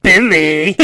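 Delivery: bad Southern accent. Mocking an actress's line, bad fake Southern accent. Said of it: "like she's trying to do the Southern accent".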